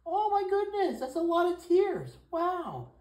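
very high, loud pet-talk exclamation; cooing amazement. Real, not acted. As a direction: marvel in a high coo over the pet. Cooing over cat's tears.

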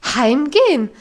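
loud, falling disbelief on Heimgehen; shocked repeat. Acted. Governess echoing Heidi's word, loud falling outrage.

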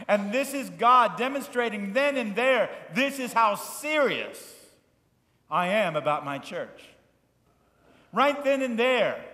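loud, high, emphatic preacher's voice; full-force preaching. Acted. Pastor thundering at his congregation about hypocrites, loud preaching. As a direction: drive the point home to the congregation.

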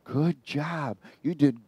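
soft, whispered flattery from the shadow; gentle demon voice. Retold or imitated. Retelling the devil's voice in the van, soft and sly. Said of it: "was real gentle".

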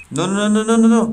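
high, loud frantic protest; rising panic, begging it to stop; panicked no. Real, not acted. Horrified protest at game event. (pánico desesperado)

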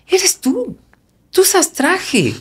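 drawn out like a friend insisting; imitating a friend. Friend's reply voiced in story.

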